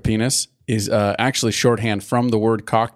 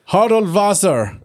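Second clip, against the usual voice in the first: put-on slow, higher German-accented name; 6 semitones higher, much slower than usual. Friend sounding out a made-up wizard name, in a German accent. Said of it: "that sounded really German".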